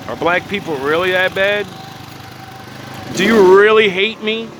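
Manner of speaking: high, loud mock victim voice, building into a squeal; mock whiny plea. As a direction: ham up a wounded outsider begging. Mocking imagined outsiders' plea.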